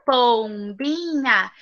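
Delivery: Pombinha stretched out slowly, syllable by syllable; slow syllable drill. Shown as a demonstration. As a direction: spell it out slowly for children. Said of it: "a sílaba é bi, nha e pom".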